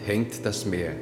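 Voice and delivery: slow, solemn declamation; poem recital. Acted. Poet proudly reciting his ode to the scientists, slow and solemn. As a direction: recite the opening like a grand ode.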